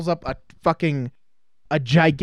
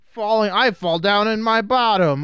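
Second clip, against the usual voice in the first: higher-pitched, hammed-up goofy lament; mock slapstick victim; 4 semitones higher than usual. Mocking the movie's pratfalls, mock-pained goofy voice. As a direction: play a clumsy oaf whining in fake pain. Mimicking slapstick fat character.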